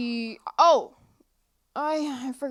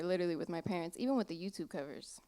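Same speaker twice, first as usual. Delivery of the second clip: ordinary delivery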